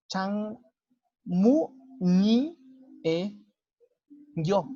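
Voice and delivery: Changmu ni e yo drawn out slowly, syllable by syllable; slow syllable demo. Shown as a demonstration. Teacher spells out Changmu ni e yo slowly. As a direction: stretch Changmu ni e yo, the n carried into ni. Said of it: "yo voy a tener que decir".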